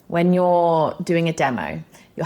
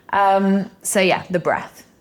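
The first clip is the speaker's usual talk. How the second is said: ordinary talk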